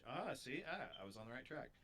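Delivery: normal talking voice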